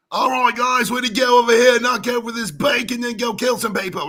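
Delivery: loud, high mock Cockney shout; bad Cockney accent. American hamming up a Cockney accent, shouting high. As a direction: ham up an American's idea of Cockney. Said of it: "That's the Cockney accent".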